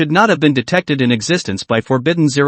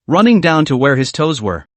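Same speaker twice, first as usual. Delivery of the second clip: ordinary delivery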